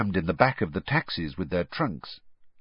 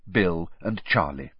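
ordinary delivery

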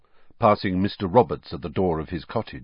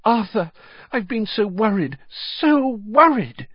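worried mother; 12 semitones higher, 4 dB louder than usual. Acted. Mother greets husband after danger.

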